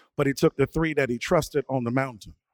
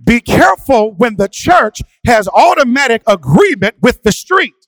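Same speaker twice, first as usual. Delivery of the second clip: raised, ringing sermon delivery; preaching; 7 semitones higher, 11 dB louder than usual. Acted. A pastor preaching to his church, fervent and raised. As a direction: preach it to the congregation with rising force.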